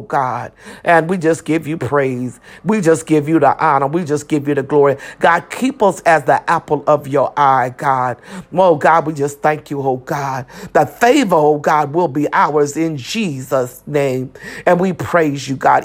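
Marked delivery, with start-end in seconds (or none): none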